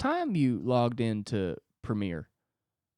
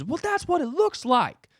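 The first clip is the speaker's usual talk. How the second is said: high, loud coach tearing into his player; tough coach; 3 dB louder than usual. Pretend. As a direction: berate him like a drill sergeant coach. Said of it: "a guy hovering over me just being like".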